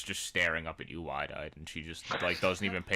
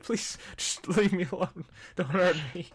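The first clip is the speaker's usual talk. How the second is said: acted frightened plea, pitched way up; cowering victim's high pleading cry; pleading shout; 10 semitones higher, 3 dB louder than usual. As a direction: beg in a high, scared voice. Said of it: "Just shout, like".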